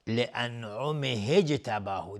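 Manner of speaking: "لانعمه اجتباه" slowly joined as an'umihij-tabahu; linking alef dropped. Shown as a demonstration. Teacher shows the dropped alef, "لانعمه اجتباه" slowly linked. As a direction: glide from "لانعمه" into "اجتباه" with no glottal start. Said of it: "الف وصل دیگه".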